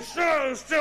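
high sharp zas of a whip; cracking whip. Acted. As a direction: snap it out like a lash.

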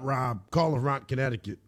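ordinary talk

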